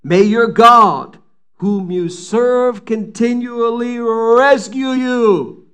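loud, slow, pleading call; shouted blessing. Acted. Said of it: "he shouts the king to Daniel".

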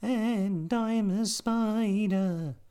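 very high, slow squeaky spider; tiny spider voice. A narrator's reading.

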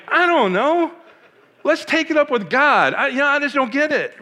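high, loud, baffled rush; excited disbelief. Retold or imitated. Telling his mentor at the altar, high and loudly baffled. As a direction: throw up your hands, half laughing at the idea. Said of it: "I'm like".

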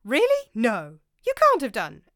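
high, loud disbelieving cries; astonished chorus. A narrator's reading. The monks to Welchlin, crying out in astonishment. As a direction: gasp out your disbelief. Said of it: "a general chorus of".

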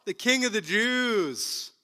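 loud, high, slow sneering hail; mock him like jeering soldiers. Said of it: "They mocked him to his face".

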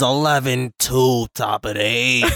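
usual speaking voice

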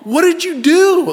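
very high, loud, drawn-out demand; hammed-up panicked parent confronting someone; mock alarmed accusation. Father confronting dentist, acted.

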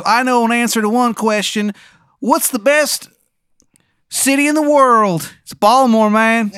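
high, loud, bad Maryland accent; Shithead Steve's terrible Maryland accent. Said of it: "he's got a terrible Maryland accent".

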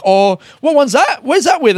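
high, caught-out travel agent; mock flustered salesperson. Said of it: "they looked flustered for a second".

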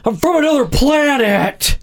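high, frantic Nick Cage shriek; Nick Cage impression. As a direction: squeal it like a panicked Nick Cage. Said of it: "That was my Nick Cage".

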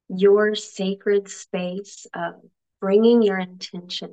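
calm, even meditation guide; measured instructions with stretched key words; guided meditation. Acted. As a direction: lead them gently into the breath, phrase by phrase.

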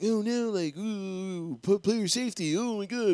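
hammed-up pearl-clutching dismay; a lightly voiced fake panic; mock faked outrage. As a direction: play a wimpy overreaction, mock scandalised. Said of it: "faked out".

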